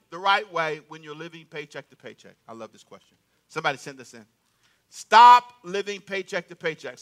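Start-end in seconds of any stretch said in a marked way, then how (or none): 4.9-7.0 slow and loud, each word pressed; loud, slow declaration. Shown as a demonstration. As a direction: proclaim it for the crowd to repeat. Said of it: "Say that with me".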